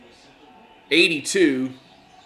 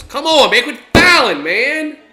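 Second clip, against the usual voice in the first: frustrated yell; 9 semitones higher, 10 dB louder, slower than usual. Real, not acted. Fan yelling at team fouling.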